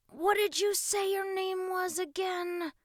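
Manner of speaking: high, slow, drawn-out query; suspicious. A narrator's reading. Boy checks the stranger's name, suspicious drawl. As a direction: stretch it out with suspicion. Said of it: "Russ asked suspiciously".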